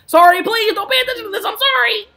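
loud, high-pitched cry of sorry; frantic apology. Real, not acted. Streamer apologising to viewers over the noise, frantic shout. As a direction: shout the apology at the top of your range.

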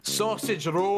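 Scottish accent. Shown as a demonstration. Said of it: "They want me to do a Scottish one".

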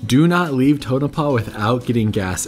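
normal talking voice

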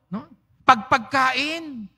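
ordinary delivery

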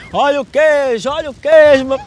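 loud street vendor calling out; singsong beach vendor call. Pretend. Prank cheese seller calling out.

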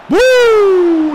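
long, loud, high "boo" whoop; amazed whoop. Real, not acted. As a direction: let out a stretched yell at the shot. Exclama ante un golazo. (alarido largo de asombro)

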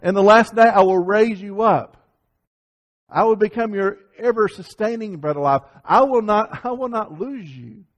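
fervent pulpit delivery; impassioned preaching. Acted. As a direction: proclaim the promise with fire. Impassioned preaching of Jesus' promise.

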